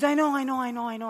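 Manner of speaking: high, rapid-fire brush-off; impatient agreement. Retold or imitated. Wife fed up with husband's key lecture, impatient brush-off. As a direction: cut in high, repeating over the lecture.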